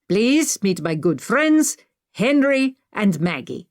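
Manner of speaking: put-on measured, lifted Indian-accented voice. A narrator's reading. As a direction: introduce friends grandly in an Indian accent. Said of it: "with an Indian accent".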